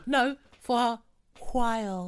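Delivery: mock posh English. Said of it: "always kind of tried to speak a little bit posh".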